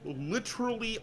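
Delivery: ordinary talk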